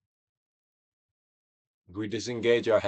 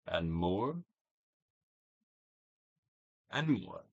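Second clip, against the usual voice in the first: guided meditation; 4 dB louder than usual. Acted. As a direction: coax the listener to let feelings pass.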